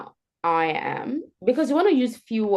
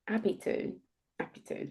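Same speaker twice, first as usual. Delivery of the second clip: soft whisper; 11 dB quieter than usual. Shown as a demonstration. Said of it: "That progression".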